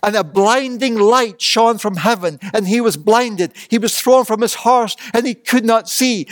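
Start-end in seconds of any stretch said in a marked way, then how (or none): none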